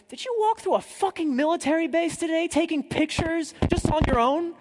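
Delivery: a high mock-outraged British contractor; contractor's outraged question. British contractor scolding the traveller, mock-outraged and high. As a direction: pitch it way up as the scandalised contractor. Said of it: "And he goes".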